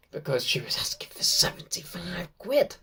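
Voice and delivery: confiding whisper; whispered aside. Real, not acted. Fan confiding the autograph price in a whisper.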